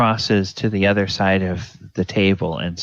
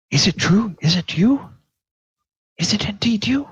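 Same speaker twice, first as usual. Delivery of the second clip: breathless disbelief; 13 semitones higher, faster than usual. Acted. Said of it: "inhales sharply".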